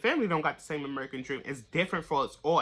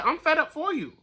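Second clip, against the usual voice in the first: ordinary talk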